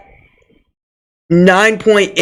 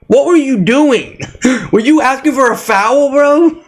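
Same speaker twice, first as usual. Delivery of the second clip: laughing disbelief; 6 semitones higher than usual. Real, not acted. Mocking Jokic's half-court throw.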